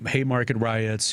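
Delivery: ordinary talk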